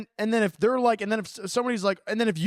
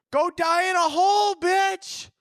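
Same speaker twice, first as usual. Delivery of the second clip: ranting yell; 8 semitones higher than usual. Real, not acted. Ranting at judgy partner.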